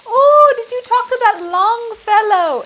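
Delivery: put-on high, slow Swedish-accented mom. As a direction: imitate a Swedish mother's accent. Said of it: "because of her Swedish accent".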